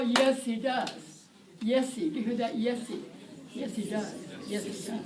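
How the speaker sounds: "Yes, he does" with the H swallowed; disappearing H. Shown as a demonstration. Teacher drilling the reduced H. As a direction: glide "Yes, he" together with no H. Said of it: "Disappearing H".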